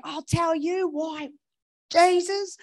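high, loud mock holler, drawn out; retold hollering. Stranger hollering from the cash registers, mock shouting. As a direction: yell it across the shop. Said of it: "absolutely hollering".

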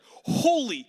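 stunned shout, cut off short; shocked exclamation. Retold or imitated. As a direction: blurt it out like you saw ten grand. Opening an envelope with $10,000.

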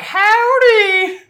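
high, loud mock-Texan holler; fake Texas accent. Co-host imitates the box robot greeting guests, fake Texas accent. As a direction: call it out in an exaggerated Texas twang. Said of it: "He has the best fake Texas accent".

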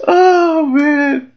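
high and breathless after laughing; winding down from a laughing fit; after laughing hard. Real, not acted. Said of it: "laughing too hard".

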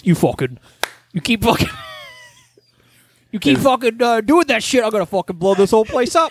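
a menacing demon turned loudmouth tough guy; Pinhead impression. Mocking friend with a blustering Pinhead threat. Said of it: "however Pinhead talks".

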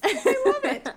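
squealing it out high and loud through a laugh; a giddy, laughing shriek of delight; swooning with laughter. Real, not acted. Delighted reaction to accent description.

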